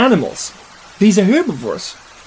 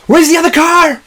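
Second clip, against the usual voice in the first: high, raised panicky cry; frantic calling; 12 semitones higher, 4 dB louder than usual. Acted. Searcher at the wreckage looking for the car, frantic cry. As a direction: cry out in alarm.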